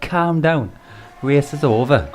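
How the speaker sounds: teasing imitation of a Welshman's accent; mimicking a Welsh accent. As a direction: put on a Welsh lilt, gently. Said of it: "That's his accent, man. His accent is fucking tremendous".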